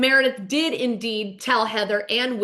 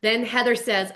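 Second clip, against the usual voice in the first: ordinary talk, nothing marked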